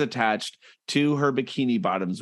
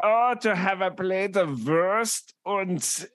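slow, savoring fake German accent; hammed-up German accent, longing for the dish; mock German accent; 3 semitones higher, slower than usual. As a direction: linger dreamily in a put-on German accent. Dreaming of German food in character.

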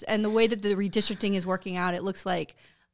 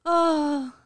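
mock self-pity; 3 semitones higher than usual. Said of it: "she's like".

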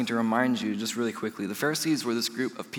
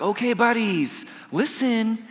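gentle buddy tone; 10 semitones higher than usual. Pretend. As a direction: coax the disciples kindly. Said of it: "He's like".

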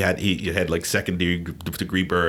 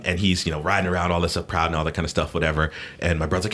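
ordinary talk

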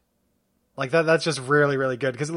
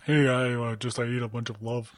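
dumb guy voice; 5 semitones lower, 4 dB quieter than usual. Pretend. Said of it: "I'm using this voice".

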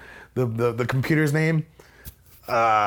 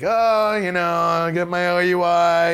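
mock cheerful; 5 semitones higher, 3 dB louder, slower than usual. Sarcastic reply to cops.

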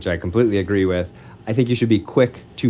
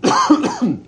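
sudden harsh cough; a real cough breaking through; chesty cough; 4 dB louder than usual. Real, not acted. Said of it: "sorry, I have this cough".

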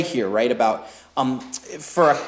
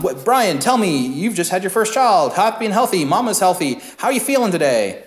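higher, chirpy interviewer voice; eager questioner; 6 semitones higher than usual. Retold or imitated. A friend quizzing the new father, bright and higher. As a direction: ask brightly like a cheery friend. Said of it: "But it's like".

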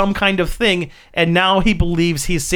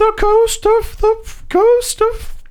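very high, slow, eerie wrestling manager; Paul Bearer voice; slower than usual. Acted. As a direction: shriek it slowly like a spooky manager. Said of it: "Paul Bearer would be doing all the speaking".

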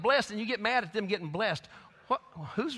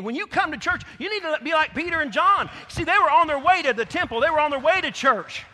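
loud, higher preacher's build-up; rising full-force preaching; 6 semitones higher, 8 dB louder than usual. Acted. Preacher urges the church to be like Peter and John, loud and rising. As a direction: push it up like a revival preacher.

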